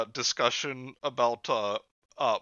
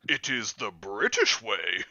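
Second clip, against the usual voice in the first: Muppet quote corrected; 4 dB louder, slower than usual. Retold or imitated. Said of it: "Oh, wait, Sam".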